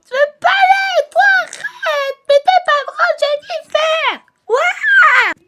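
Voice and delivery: put-on very high slow toddler whine; little girl whining at her big sister. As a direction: drag it out like a sulky little girl.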